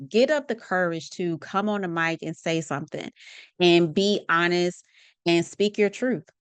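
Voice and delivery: ordinary delivery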